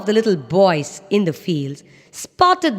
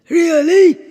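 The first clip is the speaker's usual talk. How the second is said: high, loud surprised donkey; 9 semitones higher, 7 dB louder than usual. Acted. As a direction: jump at the good news. Donkey excited question.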